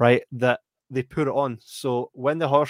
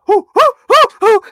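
high, loud hoo-hoo-hoo blowing out heat; puffing like hot pasta just hit the tongue; burnt mouth hooting; 12 dB louder than usual. Retold or imitated. Mocking someone burned by hot pasta, high loud hooting. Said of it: "you're like".

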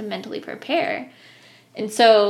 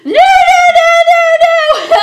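very high, loud, drawn-out wail; shrieked, stretched-out refusals; retold panicked screaming; 16 dB louder, slower than usual. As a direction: re-enact screaming at the top of your lungs. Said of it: "screaming on the top of my lungs".

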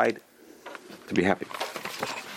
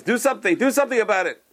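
urging; 9 semitones higher than usual. Retold or imitated. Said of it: "he tells all the rabbis".

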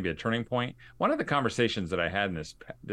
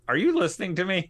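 higher, quick incredulous question; exasperated; 9 semitones higher, 4 dB louder, faster than usual. Retold or imitated. Grown child to dad who keeps brushing off the worry, exasperated. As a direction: demand to be heard, half-playfully.

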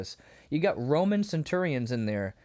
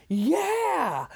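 high, drawn-out cheering yell; imitated scream; 4 dB louder than usual. Imitating John's reaction to the healed man, screamed cheer. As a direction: whoop it like a modern guy.

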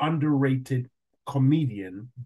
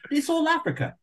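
high-pitched mocking imitation; squeaky mock protest; clueless casting agent. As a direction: mimic someone dismissive in a much higher voice. Mimics clueless casting people, high voice.

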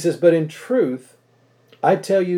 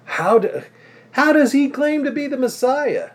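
incredulous townspeople; 12 semitones higher, 4 dB louder than usual. Retold or imitated. Townspeople doubting Jesus.

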